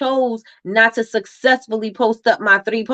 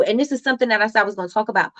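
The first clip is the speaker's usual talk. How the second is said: ordinary talk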